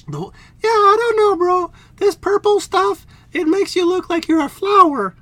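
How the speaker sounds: high, loud indignant princess; high huffy princess. Acted. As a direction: squeal it high and put out. Princess figure voice.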